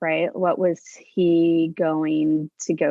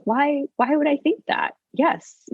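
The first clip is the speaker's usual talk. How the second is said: much higher, incredulous retold self-question; self-challenging; 9 semitones higher than usual. Mother challenging her own assumption about her son, incredulous. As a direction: catch yourself and push back, pitched right up.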